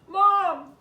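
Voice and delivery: high, loud frantic yelling for Mom. Acted. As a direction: shout for Mom at the top of your lungs. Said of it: "I was yelling at the top of my lungs".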